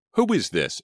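high, loud sharp question; demanding. A narrator's reading. Selena to an unknown caller, demanding. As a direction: demand an answer at once. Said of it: "she demanded".

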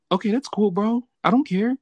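high, breezy okay that's cool; mock nonchalant. Answering his crying friend, light and high-pitched mock casual. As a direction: play it off like it's nothing. Retold casual reply to coming out.

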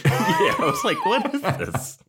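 high, laughing through the quote. Real, not acted. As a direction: squeal it out laughing. Laughing at the scene.

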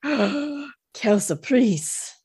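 slow, drawn-out mock French "Quelle surprise"; bad French accent. Shown as a demonstration. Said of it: "my French accent is atrocious".

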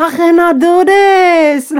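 high, loud, stretched wail; hammed-up cry of being unable to cope; mock overwhelmed. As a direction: drag it out in mock despair. Retells being overwhelmed by birthday replies.

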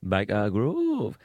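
drawn-out Geordie vowels; Geordie accent. Shown as a demonstration. Comedian quoting a TV show title in a mock Geordie accent. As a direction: stretch it out in a put-on Geordie accent. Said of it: "That was my Geordie accent".

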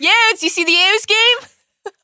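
high, slow, hammed-up Baltimore accent. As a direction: draw it out like a Baltimore sports fan. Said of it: "really hear the Baltimore accent come out".

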